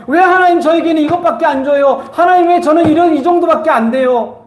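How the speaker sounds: high-pitched acted grievance; complaining to God. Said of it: "계속 원망하고 불평하고만 있지 않습니까". (하나님께 따지듯 높게 불평하는 흉내)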